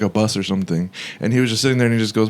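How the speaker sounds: usual speaking voice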